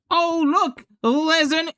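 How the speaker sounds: high, hammed-up excited shout of spotting something; mock excited Game Chap. Reenacting the Game Chap meme, hammed-up high excitement. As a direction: point and yell like you've spotted a giant E far off. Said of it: "For those who don't know what this is a reference of".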